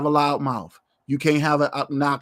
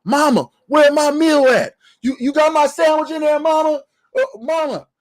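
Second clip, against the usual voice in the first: put-on high, whiny mama's boy calling for his mother; spoiled grown son; 9 semitones higher, 4 dB louder than usual. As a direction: squeak it up like a pampered son wanting his sandwich. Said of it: "Negroes like Baby Boy exist".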